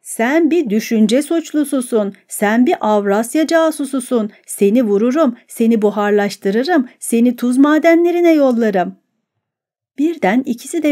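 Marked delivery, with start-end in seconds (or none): none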